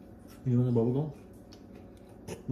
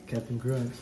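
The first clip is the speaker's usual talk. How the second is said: ordinary talk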